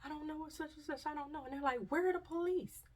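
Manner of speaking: high frantic bystander voice; high frightened voices of fleeing people; panicked crowd. Retold or imitated. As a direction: mimic a terrified stranger asking. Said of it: "I hear people saying".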